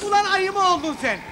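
high, alarmed yelling; panicked cry for help. Acted. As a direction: cry out as if attacked by a bear. Attacked by bear, calls for help.

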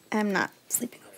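hushed whisper. Acted. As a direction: whisper it so no one hears. Secret late-night admission.

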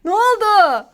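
high, loud surprised question. Real, not acted. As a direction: ask in sudden alarm. Asking after the laugh. (şaşkın soru)

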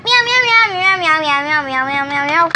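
kid's cat impression, meowing in a run; cat meows. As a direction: meow like a little cat, winding down. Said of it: "I know how to do that".

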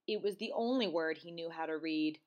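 plain reading voice

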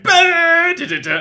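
loud high burr sound effect; fanfare noise. Retold or imitated. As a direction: blurt out a news fanfare. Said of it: "just went".

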